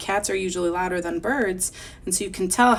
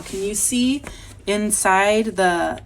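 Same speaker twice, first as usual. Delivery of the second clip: usual speaking voice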